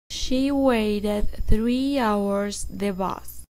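very slow, each word spaced for repeating; slow model sentence. Shown as a demonstration. Teacher dictating slowly for students to repeat. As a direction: read it slowly so students can echo every word. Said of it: "Repitan después de mí".

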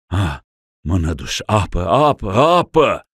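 rising, desperate cry for water; gasping plea. Acted. Dying ruler begging for water. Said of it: "hârcâind de turbare și de mânie".

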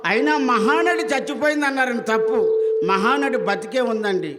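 loud, scornful accusation; indignant protest. Acted. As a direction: call out the fakery loudly. Son-in-law protests at the fake wailing.

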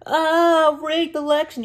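high mocking whine of a sore loser. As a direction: sneer it in a put-on high voice like a whiny loser. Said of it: "the whole world saying".

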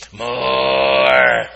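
low, loud monster growl; deep monster. Pretend. Monster child answering mom, deep growling. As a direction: growl like a breakfast beast.

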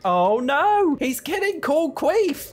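high, loud horrified wail; horrified cry. Real, not acted. Gamer watching the Reaper take her hen, horrified high cry. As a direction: cry out as your hen is taken.

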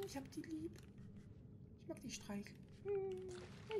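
put-on very high whispery little-girl voice; creepy child voice. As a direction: coo like a creepy doll-loving child. Coos over a creepy doll.

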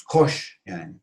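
normal talking voice

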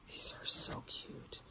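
very soft, gushing whisper; adoring whisper. Real, not acted. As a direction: whisper it, melting over cuteness. Gushes over the gifted pants.

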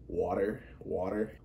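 imitated American "water", rising tune; North American accent. Showing friends' accents, mock North American. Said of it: "In the U S and Canada".

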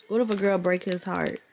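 ordinary talk

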